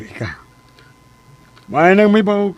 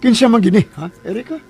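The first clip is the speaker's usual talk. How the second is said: raised, pressing question; demanding; 6 semitones higher, 3 dB louder, faster than usual. Acted. Father demands to know the baby's father. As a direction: push her hard for the name.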